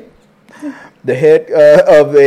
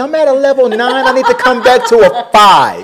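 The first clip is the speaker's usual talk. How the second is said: anxious moviegoer; 9 semitones higher, 4 dB louder than usual. Pretend. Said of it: "It's like".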